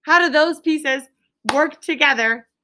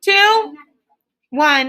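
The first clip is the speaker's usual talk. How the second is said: raised, high call to the room; loud countdown; 5 semitones higher, 5 dB louder, much slower than usual. Real, not acted. Teacher calling students back from partner talk, loud high countdown.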